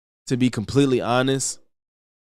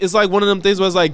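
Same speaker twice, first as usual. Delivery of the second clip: ordinary delivery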